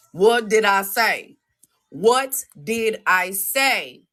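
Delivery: slow, drawn-out question; slow taunt. Real, not acted. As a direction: stretch each word out.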